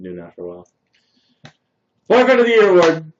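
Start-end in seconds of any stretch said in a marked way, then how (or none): 2.1-3.1 high, loud mock announcement of an award; mock proud exclaim. Boyfriend mocking himself to chat with a loud, high fake award cry. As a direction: crown yourself with a loud fake fanfare.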